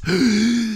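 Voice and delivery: long high gasp; exaggerated intake of breath. A narrator's reading. Girl invited to the concert, exaggerated gasp. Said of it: "I gasped".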